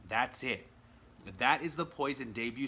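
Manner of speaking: ordinary talk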